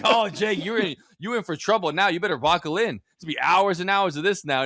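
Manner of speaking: high, gleeful mock warning; Denzel Washington. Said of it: "he's just freaking the fuck out".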